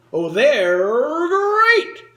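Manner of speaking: long drawn-out rising roar; cartoon tiger slogan. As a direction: stretch it out like a big cartoon mascot. Singing out Tony the Tiger's slogan.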